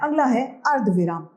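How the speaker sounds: ordinary delivery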